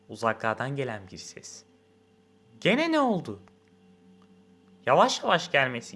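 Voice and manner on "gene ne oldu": high, thin, songbird-light question; thin, nightingale voice. A narrator's reading. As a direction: pipe it sweetly from a distance. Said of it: "İnce, bülbül gibi".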